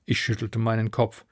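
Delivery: usual speaking voice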